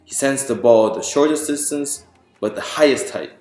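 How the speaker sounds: usual speaking voice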